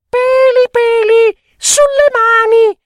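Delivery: put-on very high, loud shrieking child taunt; loud high teasing squeal. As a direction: shriek it with glee.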